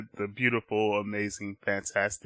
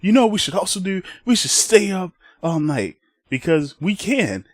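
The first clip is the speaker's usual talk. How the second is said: higher-pitched mock-rebel boasting; gleeful Ozpin; 7 semitones higher than usual. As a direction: pitch it up like a naughty plan. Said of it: "and he said".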